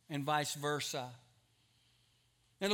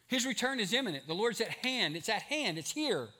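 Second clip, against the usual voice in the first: raised, urgent pulpit voice, building louder; fervent preaching; 6 semitones higher, 4 dB louder than usual. Real, not acted. Preacher tells church the Lord's return is near, fervent preaching. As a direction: drive it home higher and harder, phrase by phrase.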